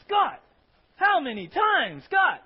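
loud, high scolding yell; angry scolding God. Acted. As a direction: scold like a fed-up parent. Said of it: "hearing God as the Old Testament God".

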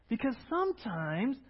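usual speaking voice

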